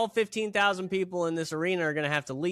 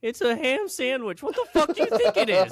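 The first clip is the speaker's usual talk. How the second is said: mock exasperation; 7 semitones higher, faster than usual. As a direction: sneer at the dumb question. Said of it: "acted quarrel in roles".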